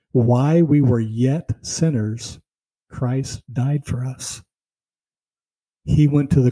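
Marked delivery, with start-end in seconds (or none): 0.1-4.4 "While we were yet sinners" drawn out slowly and weightily; slow each word down so it lands; slow, solemn emphasis. Shown as a demonstration. Preacher quoting scripture to the congregation, slow and solemn. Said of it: "now listen to this".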